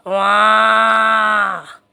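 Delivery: low drawn-out mooing call; a cow lowing from the yard; long cow moo. Pretend. Cow lowing in doll play.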